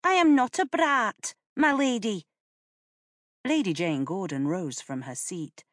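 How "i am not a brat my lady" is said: put-on a little girl's high, louder retort; defiant child. A narrator's reading. The child talking back to Lady Jane, high defiant voice. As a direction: answer back like a proud young girl.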